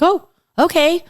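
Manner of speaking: high, drawn-out surprised oh. Retold or imitated. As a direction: sound pleasantly caught off guard. Surprised to be invited.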